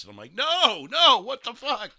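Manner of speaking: ordinary talk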